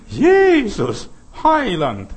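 high, loud acted cry; rapturous calling out. Mary whose eyes love has opened, high loud cry of adoration. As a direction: call out the names in adoration.

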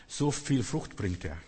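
ordinary talk, nothing marked

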